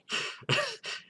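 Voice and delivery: high, breathless quiet laugh; helpless wheezy giggle. Real, not acted. Reader cracking up at cell phone line, laughing.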